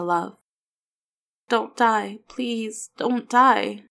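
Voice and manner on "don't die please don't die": put-on higher-pitched, pleading child; pained begging, voiced higher; mumbled plea. A narrator's reading. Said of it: "Will mumbled".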